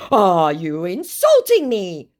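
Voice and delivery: slow, put-on hurt voice; mock offended scammer. Woman reading the scammer's offended reply, in a slow mock-hurt voice. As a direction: play the scammer taking offence.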